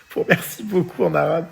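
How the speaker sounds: laughing. Real, not acted. Fou rire en se souvenant.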